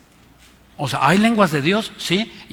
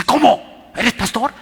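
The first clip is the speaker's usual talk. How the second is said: shocked question; 7 semitones higher, 4 dB louder, slower than usual. Retold or imitated. Driver's shocked retort in story.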